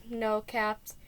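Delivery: usual speaking voice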